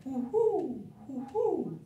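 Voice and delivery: soft hooting, fading out; owl hoot. Pretend. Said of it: "like an owl".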